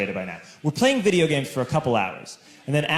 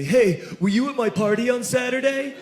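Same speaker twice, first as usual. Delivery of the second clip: lightly voiced teen; 7 semitones higher than usual. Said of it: "he says to me".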